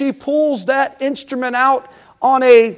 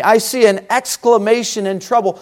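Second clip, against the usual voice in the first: ordinary talk, nothing marked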